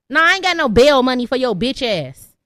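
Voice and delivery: ordinary talk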